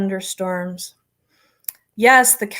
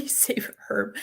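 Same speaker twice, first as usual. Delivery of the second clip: whispered aside; 12 dB quieter than usual. Real, not acted.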